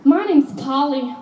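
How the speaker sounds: high, loud, slow mock scared tourist. Comic imitating a nervous tourist to the audience, high and loud. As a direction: lay on the dumb tourist voice. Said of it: "She's like".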